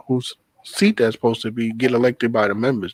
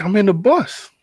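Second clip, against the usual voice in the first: incredulous; 8 semitones higher than usual. Retold or imitated. Incredulous retort to the guy.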